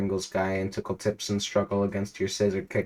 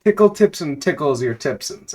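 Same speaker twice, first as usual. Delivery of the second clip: still giggly; 6 semitones higher, 7 dB louder than usual. Real, not acted. Rereads line after laughing.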